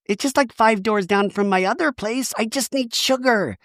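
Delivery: high, put-on dodging voice; hammed-up evasive Shannon; Shannon impression. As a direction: brush off the question in a mock voice. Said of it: "And Tamara's like".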